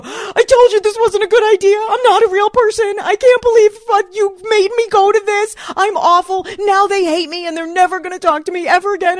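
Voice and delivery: high, loud, tearful yell. Retold or imitated. On the street yelling at boyfriend, loud high tearful. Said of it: "I proceed to yell at him".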